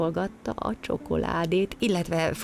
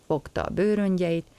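ordinary delivery, nothing marked

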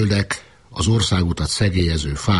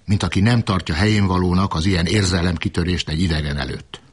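plain reading voice

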